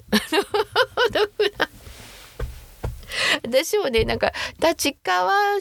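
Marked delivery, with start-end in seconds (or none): 0.0-1.7 high, drawn-out helpless laughter through the words; laughing. Real, not acted. Streamer amused at own puzzlement, laughing. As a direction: break up laughing while repeating the question.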